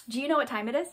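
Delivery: ordinary delivery, nothing marked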